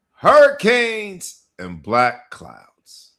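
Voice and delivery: slow, loud, high title announcement; announcer title read. Acted. Host announcing this week's episode title to listeners, slow and loud. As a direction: drag out the title like a hype man. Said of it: "announcing episode title".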